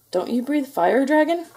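high, rising, puzzled question; bewildered little knight; squeaky knight. A narrator's reading. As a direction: ask in a squeak that climbs at the end. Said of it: "squeaked a thin".

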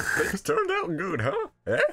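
very high, slow squeaky nudge; happy cartoon sidekick. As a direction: tease knowingly in a silly falsetto. Same squeaky character nudging Frankie.

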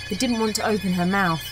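usual speaking voice

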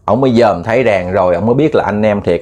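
ordinary talk

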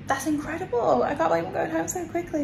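high, fast and delighted; excited. Real, not acted. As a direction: gush with disbelief at the news. News of going home.

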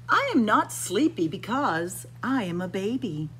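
usual speaking voice

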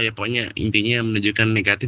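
usual speaking voice

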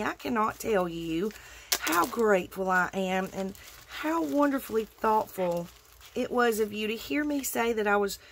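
ordinary talk, nothing marked

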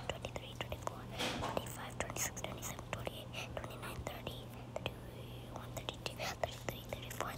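breathy whisper, barely audible; whispered counting. Real, not acted. Counting options under breath.

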